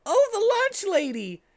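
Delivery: high, loud delighted shout; happy shout. A narrator's reading. Tails greeting the lunch lady, high and happily shouting. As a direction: cheer her arrival. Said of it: "shouted Tails in a happy way".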